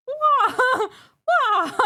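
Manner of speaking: high, loud little battle cry Wa; tiny fierce shout; passionate shout. Shown as a demonstration. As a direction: yell "Wa" with passion but small. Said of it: "Shouting Wa like you're passionate, but little".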